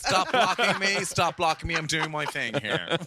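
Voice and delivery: loud hammy stage protest; mock-indignant actor, words stretched.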